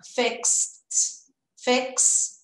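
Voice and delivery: "fixed" said fékst, one syllable, K S T closing; ending S and T. Shown as a demonstration. Teacher showing students the past of fix, clipped ending. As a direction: model "fixed" with a tight KST cluster. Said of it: "já tem o S e o T".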